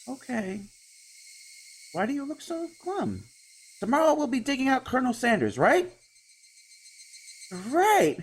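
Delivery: put-on high, bright, eager girl voice; chirpy girl trying to lift his mood; cheerful young girl. Girl character cheers him up.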